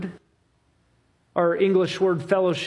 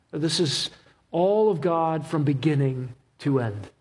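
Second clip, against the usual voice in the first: normal talking voice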